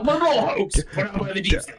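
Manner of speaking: high mock conspiracy rant; Alex Jones. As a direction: cry out like an alarmist host. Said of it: "start doing Alex Jones stuff".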